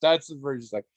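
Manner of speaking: usual speaking voice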